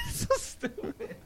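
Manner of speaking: high, wheezing laugh through the words; helpless giggling over the line; breathless laugh. Real, not acted. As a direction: laugh so hard the voice goes airy and high.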